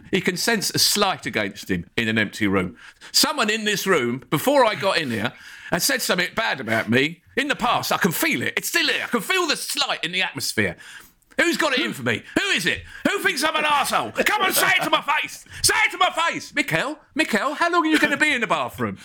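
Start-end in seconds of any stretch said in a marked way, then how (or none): 3.1-16.5 rising paranoid manager voice, building to a high yell; paranoid Arteta impression. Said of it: "Arteta can sense injustice". 16.5-19.0 high, fast, laughing call; wife calling out. Retold or imitated. Arteta's wife calling through door.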